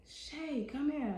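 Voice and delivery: high calling voice, Shay stretched up; mom calling out. Retold or imitated. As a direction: call her name across the house. Mom calls daughter from door.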